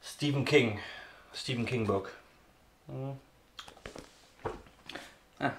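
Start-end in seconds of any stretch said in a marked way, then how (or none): none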